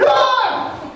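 short high-pitched mock scream; startled shriek. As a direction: shriek as if a ghost just appeared. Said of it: "cried out at the top of her voice".

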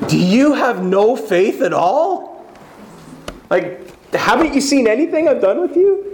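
high, incredulous scolding; acted-out fed-up teacher; exasperated rebuke. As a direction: plead in disbelief, pitch leaping up. Exasperated Jesus scolding frightened disciples.

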